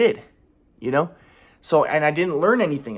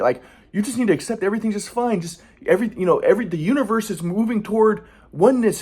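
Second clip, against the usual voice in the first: higher, smug guru-like grandpa; mocking grandpa; 4 semitones higher than usual. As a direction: mimic grandpa preaching calm optimism. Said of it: "my grandpa's like".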